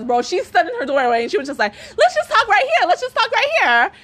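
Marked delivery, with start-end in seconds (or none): 2.0-3.9 high, whiny mimicry; mocking imitation. Quoting the woman standing in her doorway, mocking imitation. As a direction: parrot her in a high mock voice.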